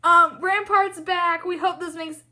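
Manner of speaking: high, slow sarcastic surprise; mock cheerful announcement. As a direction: announce it like an upbeat studio ad. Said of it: "they're like".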